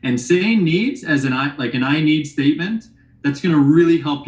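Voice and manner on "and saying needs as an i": ordinary delivery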